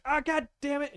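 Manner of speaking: high, sudden pained yelp of a curse; startled curse. Acted. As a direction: hit by the ball, blurt it out. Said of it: "She was like".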